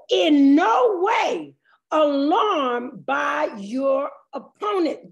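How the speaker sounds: very slow, stretched proclamation of the verse; weighty, deliberate Bible reading; emphatic scripture reading. Real, not acted. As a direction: draw out each phrase from the pulpit. Reads verse with strong emphasis.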